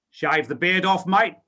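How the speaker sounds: put-on Australian accent. Australian boss telling candidate to shave, Australian accent. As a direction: deliver the punchline in a blunt Australian accent. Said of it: "so he said in an Australian accent".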